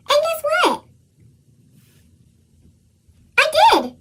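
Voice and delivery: high, bright-eyed build to the news; excited reveal. Acted. Child telling the reader about her valentines, excited. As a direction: tease then burst with joy.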